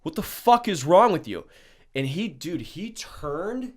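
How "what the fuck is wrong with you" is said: loud, higher furious call-out; retold angry outburst; angry yell. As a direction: shout at a friend gone wild. Confronting the friend outside.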